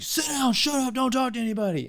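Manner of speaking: high, barked orders imitating a drill instructor; mock drill instructor. Recalling boot camp staff greeting recruits, a mock barking drill instructor. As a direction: snap out the orders like a hammed-up drill sergeant. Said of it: "and they're like".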